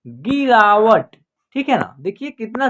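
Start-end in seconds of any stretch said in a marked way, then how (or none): 0.1-1.2 slow, syllable by syllable; slow clear word demo. Shown as a demonstration. Teacher reading a word to pupils, slowly and clearly. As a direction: sound it out slowly for the class. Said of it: "क्या उच्चारण होगा इसका".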